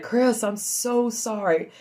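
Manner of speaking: tender husband, slow; slow sympathetic. Retold or imitated. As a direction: stretch it out tenderly. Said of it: "He's like".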